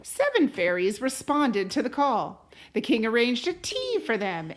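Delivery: unhurried read-aloud voice, rising on the opening lines; storybook narration. A narrator's reading. Parent reading a fairy tale to a child, gentle storytelling. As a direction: tell it like a bedtime story to a child. Reads a picture book aloud.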